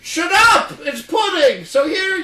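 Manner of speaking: shouting. Acted. Kids acting silly character.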